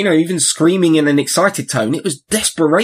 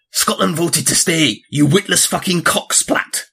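slow, deliberate Scottish insult; drawn-out Scottish accent; 3 semitones higher, slower than usual. Acted. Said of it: "I'll do it in the accent".

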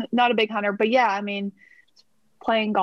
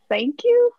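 sudden very high, louder sarcastic thank you; a bright fake-cheerful thanks, pitched way up; mock chirpy thanks; 14 semitones higher, 5 dB louder than usual. Writer mock-thanking producers for a backhanded compliment, high and sarcastic. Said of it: "And I'm like".

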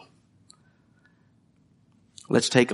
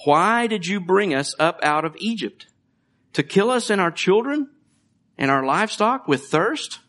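higher, whiny mock complaint; grumbling people; 6 semitones higher than usual. People grumbling against Moses, high whiny complaint. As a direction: whine at Moses like a thirsty crowd. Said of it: "grumbled against Moses and said".

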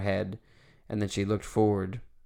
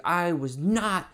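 high, slow indignant denial; imitated anger; 8 semitones higher, slower than usual. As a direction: protest it hotly as the angry driver. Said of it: "so angry, and, like".

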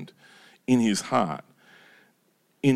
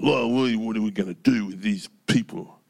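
grumbling, faithless request to God; exasperated grumble; grumpy. Acted. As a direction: complain to the Lord about these people. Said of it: "he's all in his grumpies".

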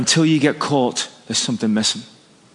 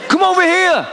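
put-on American accent; 14 semitones higher than usual. Player repeating his summons from the bench, American accent. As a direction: holler it over in a mock American accent. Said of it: "That's my American accent".

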